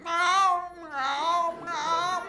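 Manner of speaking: high, drawn-out mock meows; talking cat. Owner imitating his talking cat, high drawn-out meows. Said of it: "he's like".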